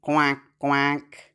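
light playful duck quack. Acted. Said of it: "Now duck".

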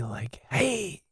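soft unvoiced whisper. Real, not acted. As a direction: lean in and whisper it. Whispered playful aside.

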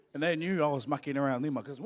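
ordinary talk

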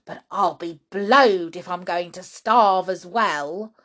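put-on emphatic, complaining old grandma; grumbling grandma.

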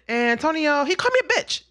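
put-on high childlike voice; imitating her son. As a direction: retell the kid's serious report, high-pitched. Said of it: "this is how he talks".

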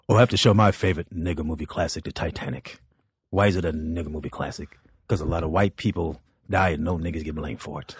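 a low, quiet imitation of Paul on stage; lightly voiced comic hosting his bit; mimicking Paul's act. Comic mimics a fellow comedian telling his joke, low and quiet.

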